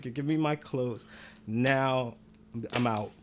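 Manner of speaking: fed up, quietly leaving; leaving the girlfriend, low and exasperated; low and done. Retold or imitated. Fed-up demand after ordeal.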